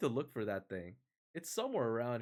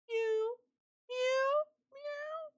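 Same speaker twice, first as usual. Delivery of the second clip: very high falsetto meowing; merry cat mew; much slower than usual. A narrator's reading. Thirsty cat answering the noble youth, merry high mews. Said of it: "merrily answered".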